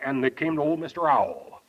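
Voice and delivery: ordinary talk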